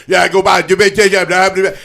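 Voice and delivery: loud, high garbled rattle; mock-local gibberish at full volume; unintelligible local directions. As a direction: bellow slurred directions nobody could follow. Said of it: "couldn't understand a damn word".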